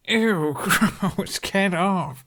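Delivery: high laughing squeal of ew; laughing disgust. A narrator's reading. Licked by the dragon, Hiccup laughs and squeals in disgust. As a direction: giggle while shoving it off. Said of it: "Hiccup laughed".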